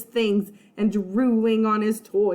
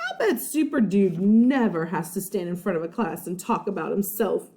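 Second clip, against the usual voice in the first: ordinary delivery, nothing marked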